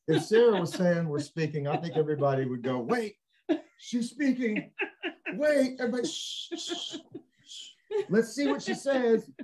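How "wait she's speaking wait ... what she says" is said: high, excited mock hush to the crowd. Mother hushing everyone so her daughter can be heard. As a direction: pitch it up and shush everyone, half laughing.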